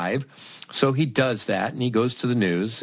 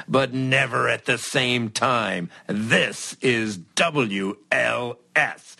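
radio DJ announce; 4 semitones higher than usual. Pretend. Going on air as DJ.

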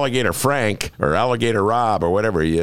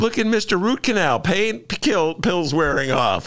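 mock teasing; 10 semitones higher than usual. Mocking Ben as drugged.

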